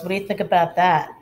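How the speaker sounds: usual speaking voice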